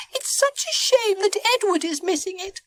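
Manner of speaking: very high character voice; high, squeaky delivery; little Bonnie. A narrator's reading. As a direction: speak in a high-pitched girlish story voice.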